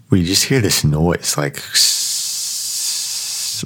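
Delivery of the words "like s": imitated hiss. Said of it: "like that".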